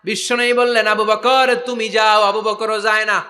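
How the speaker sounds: high-pitched chanted storytelling; impassioned preaching. Acted.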